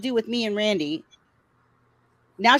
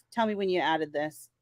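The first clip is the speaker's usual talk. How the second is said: ordinary talk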